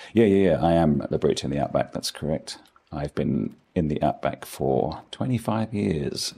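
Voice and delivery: ordinary talk, nothing marked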